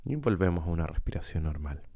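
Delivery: calm guide with long, unhurried pauses; guided meditation. Acted. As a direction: lead listeners gently through breathing, leaving space between phrases. Guía una meditación de respiración. (meditación guiada, tranquila y pausada)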